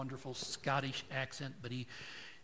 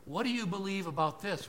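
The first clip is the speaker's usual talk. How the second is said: higher-pitched, louder probing question; a gatekeeper quizzing a newcomer, voice lifted; Peter questioning; 6 semitones higher, 3 dB louder than usual. Acted. Preacher voicing Saint Peter quizzing the convict, high and pressing. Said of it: "the angel or Peter asks".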